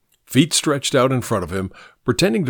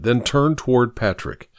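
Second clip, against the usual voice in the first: ordinary delivery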